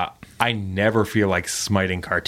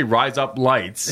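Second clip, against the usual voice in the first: slow and careful; 3 semitones higher, much slower than usual. Shown as a demonstration. Host showing the Australian joke, slowly and deliberately. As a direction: rise up lights said slowly, each word separate. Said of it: "quickly, it's".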